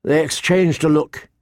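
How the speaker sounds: ordinary delivery, nothing marked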